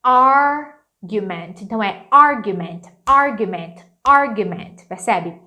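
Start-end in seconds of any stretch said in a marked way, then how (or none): 0.0-1.6 Argument said slowly and loudly, AR hit hardest; stress on AR. Shown as a demonstration. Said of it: "a parte mais forte daqui é no R". 2.1-4.8 argument with the first syllable punched louder; stress on AR. Shown as a demonstration. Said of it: "a parte mais forte daqui é no R".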